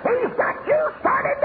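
high, shouted sermon peak; preaching at full force. Real, not acted. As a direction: preach it out with fire.

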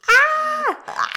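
high shocked squeal; a sudden high yelp of surprise; surprised shriek. Real, not acted. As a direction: shriek at the plot twist. Shocked reaction to reveal.

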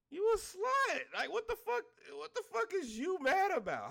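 high-pitched, incredulous outburst; comic high-voiced indignation; exasperated rant. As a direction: challenge them with pitch shot way up. Exasperated rant at imagined protesters.

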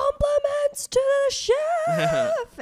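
loud, drawn-out, high-pitched holler; obnoxious yell. Retold or imitated. Server announcing praise to the kitchen, obnoxious high yell. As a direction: holler it slowly, stretching the words. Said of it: "just yelling".

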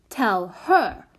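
put-on little-girl echo with HER swooping up; sniffy. A narrator's reading. Small child echoing the older girl's order, sniffy. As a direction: sniffily lift and drop "her". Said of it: "said the prodigy with a sniff".

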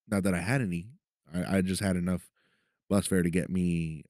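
ordinary talk, nothing marked

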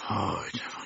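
barely voiced whispered repeat; whispered pitying echo. A narrator's reading. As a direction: breathe it out to yourself, almost silent. Doctor repeats it to himself.

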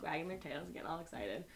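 ordinary talk, nothing marked

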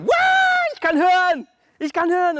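very high, loud joyful shout; ecstatic shout. Retold or imitated. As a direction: scream the joy of hearing again. Deaf woman suddenly hears. (ekstatischer, sehr hoher, lauter Freudenschrei)

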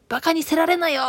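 high, loud scolding of バカにせられなよ in Okayama dialect; angry Okayama scolding. Retold or imitated. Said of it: "岡山弁じゃなーって思うて". (高く強く岡山弁で叱る)

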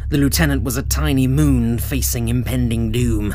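plain reading voice

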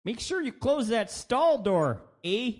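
slow, high-pitched mock Canadian; American's exaggerated Canadian, with a friendly reminder lilt; Canadian accent. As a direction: put on a hammy Canadian accent. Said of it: "That's my American doing a Canadian accent".

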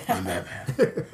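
gravelly Batman growl with a laugh. Pretend. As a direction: growl it like the caped crusader. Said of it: "Sound like Batman".

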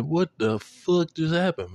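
drawn-out high disbelief; slow disbelief. Retold or imitated. Vlogger retelling his confusion, drawn-out and high. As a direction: stretch it out, baffled.

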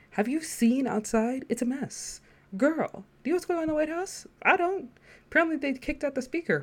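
high-pitched, incredulous venting; exasperated rant. Real, not acted. Vlogger venting to viewers about the world, exasperated and high-pitched. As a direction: vent in animated, exaggerated disbelief.

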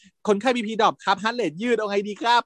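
high, very slow anxious report to the senior; urgent doctor report. Retold or imitated. Junior doctor reporting the crash to his senior, anxious. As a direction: ask for orders like a worried young doctor. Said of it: "ก็บอกว่า".

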